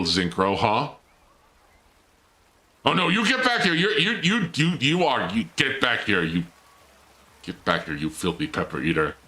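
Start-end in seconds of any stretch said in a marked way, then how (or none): 2.8-6.2 high, playful scolding of a runaway; mock scolding. Player to the escaping Zincro robot, mock scolding. 6.2-9.2 low, softened mock threat; mock menace. Player to the pepper-eating robot, mock menace.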